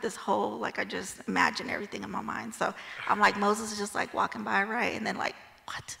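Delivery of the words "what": hushed, breathless whisper of amazement; startled whisper. Pretend. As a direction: whisper it like you can't believe your eyes. Moses startled by burning bush.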